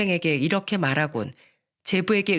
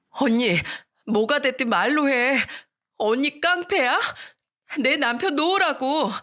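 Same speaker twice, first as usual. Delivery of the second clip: desperate high pleading; 6 semitones higher than usual. Acted. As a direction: beg her sister to let go. Sister begs her to let go. (남편을 놓으라고 언니에게 높은 소리로 애원하듯)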